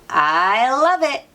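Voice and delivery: ordinary delivery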